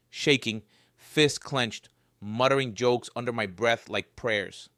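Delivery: ordinary delivery, nothing marked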